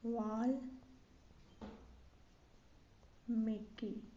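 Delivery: वाल्मीकि drawn out with a long gap; spelled by syllables. Shown as a demonstration. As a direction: dictate वाल्मीकि very slowly, starting on v. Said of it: "वा वाला होगा".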